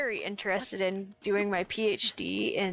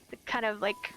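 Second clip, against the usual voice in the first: normal talking voice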